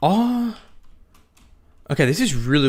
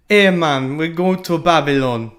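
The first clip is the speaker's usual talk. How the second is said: Jamaican accent; 5 semitones higher, 4 dB louder, slower than usual. Shown as a demonstration. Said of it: "but in a Jamaican accent".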